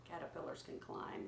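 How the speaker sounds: usual speaking voice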